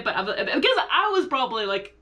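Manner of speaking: ordinary talk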